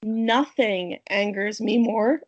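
slow line that breaks into a crackle; witch cackle. Real, not acted. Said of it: "my little witch cackle".

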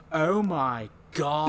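put-on older-sounding girl. Classroom girl gasping at the bird, slow high mock awe. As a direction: ham up a too-old kid gasping in class. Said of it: "This one girl who sounds like she's much older than everybody".

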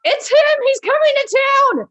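very high, loud terrified yell; panicked shout. Acted. Panicked crowd warning.

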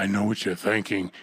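low, soft, knowing talk-show host; Oprah voice. Retold or imitated. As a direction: purr it like a confiding TV host. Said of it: "let me do the Oprah voice".

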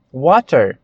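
"water" with a crisp true T; British pronunciation. Shown as a demonstration. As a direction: sound the T fully in "water". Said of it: "A mesma coisa acontece".

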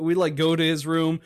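ordinary talk

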